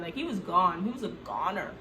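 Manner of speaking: ordinary talk, nothing marked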